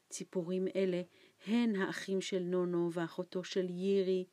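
ordinary delivery, nothing marked

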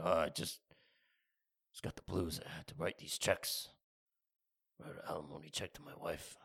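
mock-glum barfly. Sad barfly voiced low.

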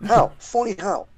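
high, mock-menacing Goodfellas voice; Joe Pesci impression. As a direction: do a rough Joe Pesci bit. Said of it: "Not a very good Joe Pesci".

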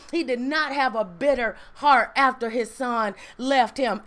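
normal talking voice

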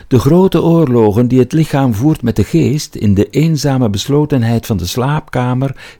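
high-pitched literature professor quoting an author; high-voiced professor. A narrator's reading. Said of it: "De hoge stem van zijn professor".

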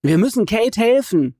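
high urgent plea; Jay pleading with his dad, shouting. A narrator's reading. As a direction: beg his father, high and loud. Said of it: "schreit Jay".

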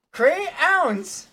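"Crayons" said high as cray-owns; phonetic pronunciation. Shown as a demonstration. Reading the word for friend, "Crayons" as cray-owns. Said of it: "I'm phonetically doing it".

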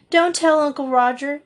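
begging her not to tell; humbly imploring. A narrator's reading. Story Girl begs Felicity to keep her secret, imploring. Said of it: "she implored humbly".

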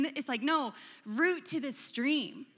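ordinary talk, nothing marked

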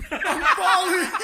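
high, loud, drawn-out wail; mock panicked yell. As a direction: imitate her panic at full volume. Stoned sister's panic, escalating.

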